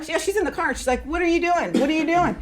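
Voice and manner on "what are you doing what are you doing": high-pitched calling-out, retold; imitated yelling. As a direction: mimic a friend yelling from the car. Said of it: "so she's yelling".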